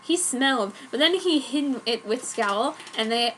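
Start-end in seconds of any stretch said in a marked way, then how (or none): none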